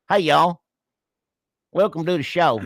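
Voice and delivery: hillbilly anchor welcoming viewers. Pretend. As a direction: open the show as a hammed-up hillbilly. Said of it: "redneck interpret".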